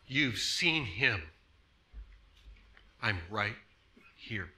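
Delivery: low, slow and reassuring, as Jesus; quiet fatherly tone. Acted. Jesus comforting the healed man, quiet and fatherly. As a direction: comfort softly, like a parent at bedtime. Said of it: "that is the tone of voice. It's Jesus".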